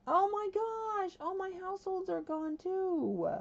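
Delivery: very high, drawn-out wail of dismay; freaking out. Real, not acted. Gamer discovers all the households are gone too, high distressed wail. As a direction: wail it high in real alarm. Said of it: "I am freaking out".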